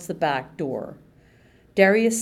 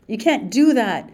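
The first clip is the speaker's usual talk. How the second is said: louder pleading cry; cried out; 5 semitones higher, 6 dB louder than usual. Acted. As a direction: cry out against the guard. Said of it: "Izzy cried".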